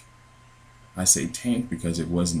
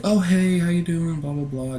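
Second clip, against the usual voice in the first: imitating a sugary friendly tone, pitched way up; mock-nice high voice, sliding down into blah-blah; sweet fake-friendly; 12 semitones higher, 3 dB louder than usual. Policewoman greeting the dreamer at the door, sweet fake-friendly. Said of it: "speak all nice and sweet to me".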